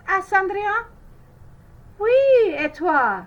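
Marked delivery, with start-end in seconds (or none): 2.0-3.2 high, loud mocking sisters; mocking laugh. A narrator's reading. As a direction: sneer it out with a laugh. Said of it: "laughed les belles sœurs".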